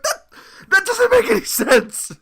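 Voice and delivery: high, loud, slow disbelief; whisper shrieking. Retold or imitated. Bandmate rejects singer's excuse, mock whisper-shriek. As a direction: stammer into a strained mock shriek. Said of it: "I am literally whisper shrieking at him".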